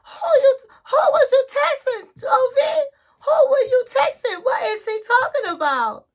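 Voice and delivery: very high mock wail of accusation; hammed-up high-pitched interrogation; upset wife. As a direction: imitate a wife frantically demanding answers. Retold wife demanding answers.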